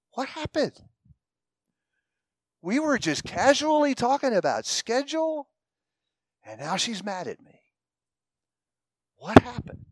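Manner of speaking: lightly voiced confused husband in the car; puzzled husband. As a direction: play a baffled husband. Puzzled husband on silent drive home.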